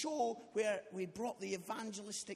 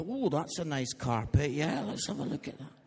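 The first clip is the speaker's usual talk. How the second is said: awkward avoidance; 9 semitones lower, 7 dB louder than usual. Acted. Audience awkwardly avoiding the performer's eyes.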